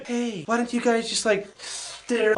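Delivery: low, hushed cartoon cloud voice; soft-spoken cloud. Cloud advising the bears, low and soft. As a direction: suggest it quietly, with a low airy softness.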